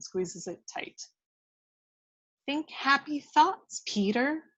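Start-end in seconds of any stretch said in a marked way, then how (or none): 2.5-4.4 tender. Acted. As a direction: comfort him gently. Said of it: "softening for the first time".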